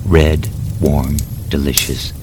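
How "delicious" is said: ordinary delivery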